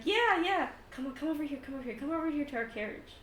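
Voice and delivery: high, excited and hurrying; eager beckoning. Acted. Squadmate beckons friends to the carriage, excited hurried call. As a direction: urge them over in an excited rush.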